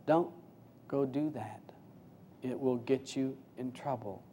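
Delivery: low, soft cautioning voice; lightly voiced inner warning, kept low; quiet inner voice. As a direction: caution softly, as a voice from within. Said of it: "the spiritual voice inside that says".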